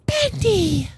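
very high, excited squeak; delighted squeal. Real, not acted. Player finally meets Bendy, delighted high squeal. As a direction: squeal in awe at a beloved character.

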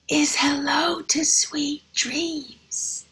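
slow, hushed whisper; bedtime whisper. Acted. Teacher whispering the bedtime page to her class. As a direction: whisper it like a child drifting off. Bedtime, child falls asleep.

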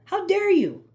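hammed-up narcissist outrage; mock shrieking outrage. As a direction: squeal it like an offended narcissist. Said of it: "That's how narcissists think".

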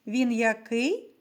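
ordinary talk, nothing marked

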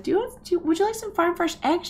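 sweet offering voice. Retold or imitated. Offering eggs to neighbour.

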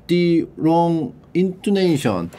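very slow, drawn out; slow careful English; slow model reading. Shown as a demonstration. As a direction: stretch every syllable. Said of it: "slow reading of example".

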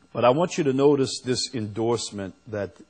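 ordinary delivery

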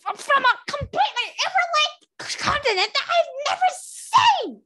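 high, loud indignant queen; haughty dragon queen's outburst; indignant rant. Acted. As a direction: rant at them, outraged and incredulous. Snowfall ranting, high and loud.

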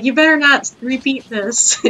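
high, loud mock stern warning; mock stern dad. Imagined dad warning his laughing baby, loud and high. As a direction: warn the kid in a hammed-up stern voice. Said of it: "he'd be like".

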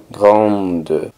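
grande with nasal A and a voiced D ending; sounded D. Shown as a demonstration. Teacher models the feminine form, grande with sounded D. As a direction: say grande as "grahnd". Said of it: "En este caso sí suena la D".

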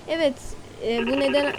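normal talking voice

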